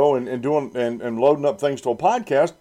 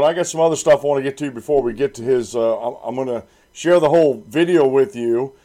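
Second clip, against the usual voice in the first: usual speaking voice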